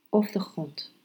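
calm, hushed meditation guidance. Acted. Leads a meditation, breath focus. (zachte, rustige meditatiestem)

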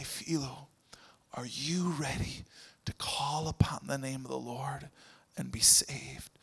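a gentle whispered invitation; hushed question. Retold or imitated. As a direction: lean in and whisper it.

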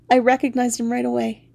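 usual speaking voice

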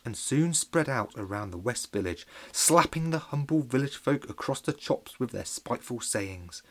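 plain reading voice

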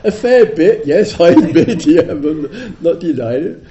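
loud laughing admission. Real, not acted. Teacher admitting the charge, laughing loudly. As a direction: concede it through laughter.

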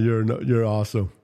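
ordinary delivery

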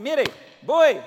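loud, high, eager call; eager volunteering. Retold or imitated. Ahimás offering to run, loud and eager. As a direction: jump forward, shouting that you're ready.

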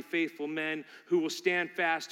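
ordinary delivery

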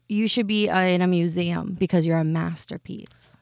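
normal talking voice

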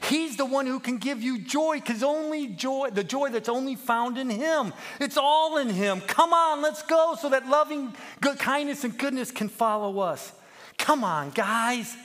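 high, shouted rallying cry; excited. A narrator's reading. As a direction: urge the flock onward at full voice. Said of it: "He grew so excited".

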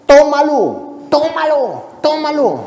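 high, eager inner plea to take it; a tempted whisper-to-self shouted high; inner voice urging. Retold or imitated. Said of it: "yo por dentro decía".

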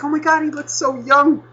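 high mock gushing voice; mocking gushing clerk. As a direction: ham up an impressed clerk. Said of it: "And I was just like".

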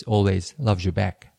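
ordinary talk, nothing marked

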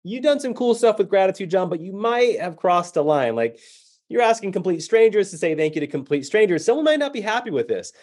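high, fretful self-talk; anxious inner voice. Retold or imitated. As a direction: worry at yourself. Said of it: "I start thinking".

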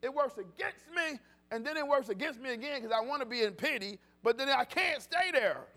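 loud, rising gospel preaching, driving each line; full-force preaching. Acted. Pastor urging the congregation to stay in church, loud preaching. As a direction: preach it out to the back pews.

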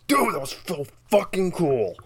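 stoked bro voice, loud and high; hyped studio dude. Retold or imitated. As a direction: gush like a stoked bro in the booth. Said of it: "He's like".

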